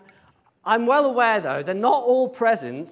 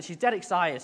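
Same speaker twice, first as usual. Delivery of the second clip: usual speaking voice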